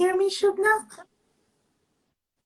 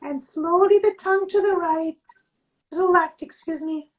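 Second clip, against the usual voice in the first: slow, calm instructor voice; guided exercise; slower than usual. Acted. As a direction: guide the class gently, drawing words out. Leads a face yoga exercise.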